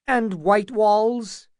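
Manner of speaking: high, slow, quavering plea; a frightened lord asking, voice quavering. A narrator's reading. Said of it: "asked Butterwell with quavering voice".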